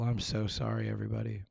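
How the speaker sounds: normal talking voice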